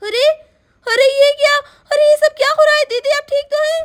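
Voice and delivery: high, loud shocked exclamation. Acted. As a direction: gasp in alarm at the sight. Neighbour finds injured woman.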